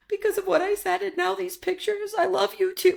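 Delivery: very high, whiny imitation of a tearful boy; mock sobbing child. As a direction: wail it like a little kid in tears, acted. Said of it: "he starts sobbing and he's like".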